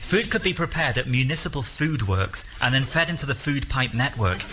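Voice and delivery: ordinary talk, nothing marked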